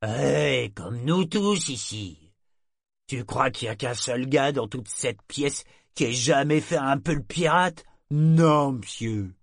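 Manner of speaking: vieux marin gouailleur un peu plus fort; matelot ivre. A narrator's reading. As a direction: se moque gentiment. Tarlock ivre et rieur. (matelot soûl qui avoue la piraterie de tous)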